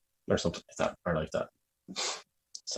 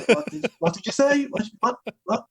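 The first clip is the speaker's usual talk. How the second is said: very high, squeaky mock confusion; baffled listeners; 3 dB louder than usual. Player imitating confused game group, mock confusion. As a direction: repeat it in high-pitched bewilderment.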